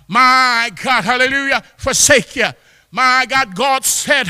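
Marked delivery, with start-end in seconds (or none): none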